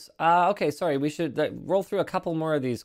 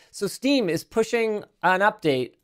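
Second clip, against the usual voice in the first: usual speaking voice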